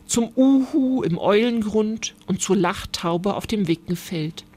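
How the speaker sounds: ordinary delivery, nothing marked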